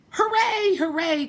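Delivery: high, loud joyful cheer; cheering clown. A narrator's reading. Clown cheering as the train reaches the top, high and loud. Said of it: "cried the funny little clown".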